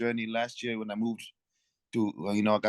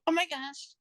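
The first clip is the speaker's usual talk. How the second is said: high, fast put-on valley girl voice.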